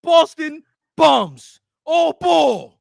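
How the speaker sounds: loud, high, slow taunting; loud mocking gloat; gloating shout. Real, not acted. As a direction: crow it over the beaten rivals. Gloating over Celtics loss.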